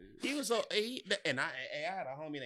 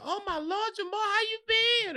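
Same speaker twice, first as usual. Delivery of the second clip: much higher mock greeting; excited teacher; 6 dB louder than usual. Teacher greeting former student Jamar, high mock delight. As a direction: gush over him like an old teacher. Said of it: "and he was like".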